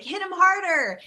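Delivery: high shouted cheer from the crowd; yelled. Retold or imitated. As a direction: call it out loud like a parade crowd. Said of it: "people would yell, like".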